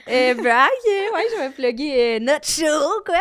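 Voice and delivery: loud, high, hyped-up plug; excited. Real, not acted. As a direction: sell the show with big energy. Loudly plugging their comedy show.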